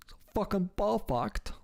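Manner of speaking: put-on goofy British accent. Streamer mimicking British speech for laughs, in a high goofy British accent. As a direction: push the British accent up into a goofy posh squeal. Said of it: "Just sounds so goofy".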